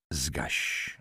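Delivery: soft, low, understated command; quiet order. A narrator's reading. Halinka tells her lover about the lamp, quietly commanding. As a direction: order it softly, without force. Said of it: "rozkazała".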